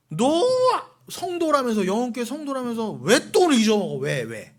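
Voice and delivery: high, loud rebuke to the flock; scolding shout. Real, not acted. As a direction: bark it like a stern pastor scolding. Preacher rebukes congregation directly. (높고 크게 꾸짖는 목소리)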